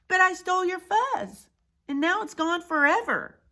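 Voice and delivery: high-pitched confession; remorseful cry. Acted. Big Bark confessing to his rescuers, crying out. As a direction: own up with a cry. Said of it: "Big Bark cried".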